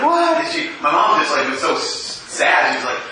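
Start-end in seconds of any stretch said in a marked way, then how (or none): 0.0-0.4 squeaky high gasped what; shocked what. Retold or imitated. Mom hearing the pregnancy news, shocked high what. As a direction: mom jolted by the news, blurting out. Mom hears daughter-in-law five months pregnant.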